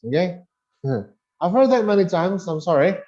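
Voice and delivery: ordinary delivery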